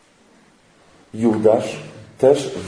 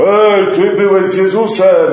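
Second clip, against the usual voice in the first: high, loud voice of an accuser; accusing shout; 7 semitones higher, 7 dB louder than usual. Acted. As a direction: call him out across the square. (ktoś z tłumu oskarża Piotra, krzyczy)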